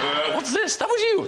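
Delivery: imitating an accusing look in a high voice; high-pitched mock disbelief at the culprit; accusing baby. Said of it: "look at you like you just did it".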